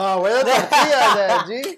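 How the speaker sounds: loud callout. Real, not acted. Loud callout about missing tortillas.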